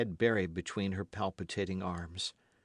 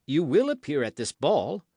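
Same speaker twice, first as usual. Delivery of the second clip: higher, louder pressing question; commanding king; 6 semitones higher, 7 dB louder than usual. A narrator's reading. King demanding the queen's promise, commanding. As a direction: pin her down.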